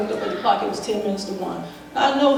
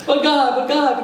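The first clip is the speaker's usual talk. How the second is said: higher, louder pleading; pleading with God; 6 semitones higher, 4 dB louder than usual. Retold or imitated. Shot on the floor, she pleads with God, higher and louder. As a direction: beg God urgently.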